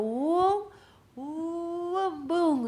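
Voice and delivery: high rising bow-draw noise, then boom; drawn-out high whine ending in a boom; imitated bowshot. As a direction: mimic a bow stretched taut and released. Imitates drawing bow and arrow hit.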